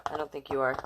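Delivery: ordinary delivery, nothing marked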